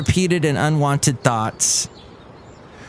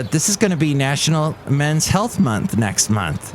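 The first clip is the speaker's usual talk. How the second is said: ordinary talk, nothing marked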